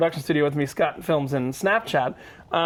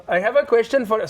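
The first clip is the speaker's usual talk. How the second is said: put-on higher, louder Indian-accented chef; 5 semitones higher, 3 dB louder than usual. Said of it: "I'm gonna do an Indian accent".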